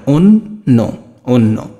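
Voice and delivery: অন্য split slowly as "on-no", then joined; o-sound pronunciation. Shown as a demonstration. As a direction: say অন্য as onno, first in pieces. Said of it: "এটা এমন উচ্চারণ হচ্ছে". (ভেঙে তারপর মিলিয়ে অন্য, "ওন্নো")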